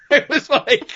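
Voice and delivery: high-pitched, laughing through the words; real laughter in the voice. Real, not acted. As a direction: break up laughing while telling it. Laughing at own retold story.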